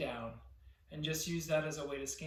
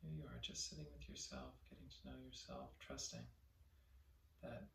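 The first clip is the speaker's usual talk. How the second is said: guided meditation; 3 semitones lower, 13 dB quieter than usual. Real, not acted. Teacher leading listeners to relax their body, soft guided meditation. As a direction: softly guide them to let go, phrase by phrase.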